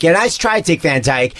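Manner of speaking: teasing British accent impression; mock British accent. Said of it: "every time I come to London".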